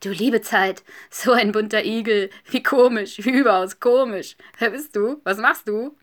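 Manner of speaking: loud, put-on hare voice; curious hare. A narrator's reading. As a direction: exclaim like an astonished, nosy hare. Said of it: "Ein Hase blickte neugierig zu ihm hinüber". (neugieriger, verwunderter Hase)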